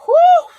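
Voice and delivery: loud, very high whoo; high excited whew. Real, not acted. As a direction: let out a loud high whoop. Excited over carnival.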